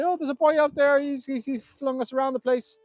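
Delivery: put-on high, loud frightened boys; panicked boys. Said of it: "the boys say".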